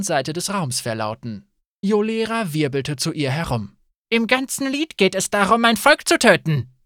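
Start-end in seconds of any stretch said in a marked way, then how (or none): 4.1-6.7 higher, louder indignant outburst. Acted. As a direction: protest the insult to her people. Yolera angrily objects.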